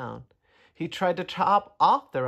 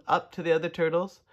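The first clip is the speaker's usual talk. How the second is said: plain reading voice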